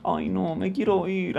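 soft, whiny little-girl put-on; mock meek girl. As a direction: play the fake-innocent mosquita muerta. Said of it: "no es muy mosquita muerta".

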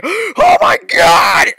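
gasping, very high exaggerated shriek; hammed-up panicked scream, long and loud; mock freak-out. Player imitating their own freak-out, mock panicked shriek. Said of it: "being like".

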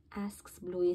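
plain reading voice

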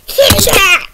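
loud, high mock attack cry; excited shout. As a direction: yell it as a pouncing puppet. Grogu doll lunges at cat.

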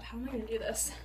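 ordinary talk

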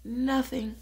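high, loud, flat-out nothing; mock cutting one-word answer; cold retort. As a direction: land it like a slammed door. Punchline retort.

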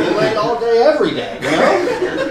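loud, high boast; hammed-up bragging, rising high at the end; put-on bragging. Fake belayer boasting to a parent, loudly.

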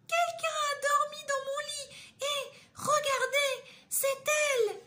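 voix très aiguë et forte de petit ourson, au bord des larmes; petit ourson qui s'écrie. Acted. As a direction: crier de surprise comme un tout petit ourson. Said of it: "le petit ourson s'écria". (Le petit ourson découvre Boucle d'Or, cri aigu pleurnichard)

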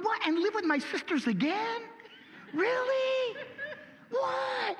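very high squeaky disbelief with a laugh; appalled Lazarus. Pretend. Lazarus told to return, squealing very high in disbelief. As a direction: shriek in comic horror at going home. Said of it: "He's going".

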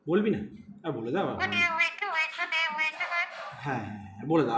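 soft, low coaxing to get the pet to say Hare Krishna. Real, not acted. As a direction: coax gently, barely above a murmur.

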